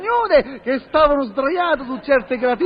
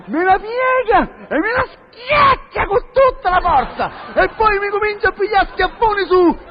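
high-pitched, stretched-out indignation; mock outrage; 5 semitones higher than usual. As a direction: wail each blow in comic disbelief. Acting out outrage at the beating.

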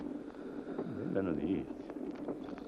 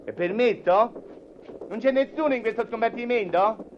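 high, mannered gentleman voice; affected traveller voice; 7 semitones higher than usual. Acted. Foppish traveller greeting a sleeping stranger. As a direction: play the pompous foreign traveller. Plays traveller in new compartment.

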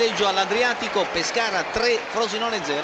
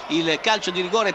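ordinary talk

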